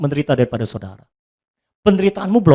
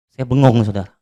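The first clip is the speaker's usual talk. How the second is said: ordinary talk, nothing marked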